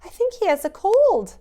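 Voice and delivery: put-on little girl telling about a sick Santa; excited girl. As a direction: pipe up like an eager little girl. Katie talking about Father Christmas.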